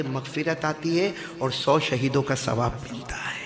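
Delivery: ordinary delivery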